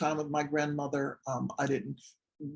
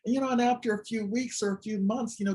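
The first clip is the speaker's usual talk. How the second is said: normal talking voice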